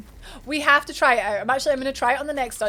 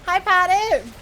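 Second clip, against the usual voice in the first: excited greeting; 6 semitones higher, 6 dB louder, slower than usual. Real, not acted. Greeting a chat viewer.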